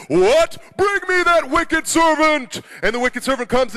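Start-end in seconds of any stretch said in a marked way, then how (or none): 0.1-2.6 high, loud royal outburst, drawn out; outraged king. Acted. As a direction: thunder the order like an angry storybook king. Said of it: "the king stands up and he says".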